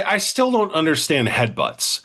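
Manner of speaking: ordinary talk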